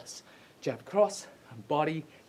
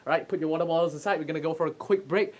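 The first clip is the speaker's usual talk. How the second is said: ordinary delivery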